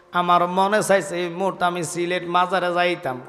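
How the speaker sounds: ordinary delivery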